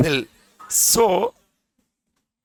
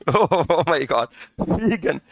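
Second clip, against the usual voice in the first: laughing; 2 semitones lower, slower than usual. Real, not acted. Student reacts to "vegan" example.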